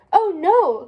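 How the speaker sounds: high, loud cry of dismay. A narrator's reading. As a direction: gasp it out in alarm. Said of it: "cried Strawberry".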